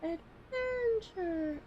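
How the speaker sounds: high, very slow stretched "Adventure"; mock epic echo. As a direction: drag it out like an epic narrator. Echoing the grand announcement.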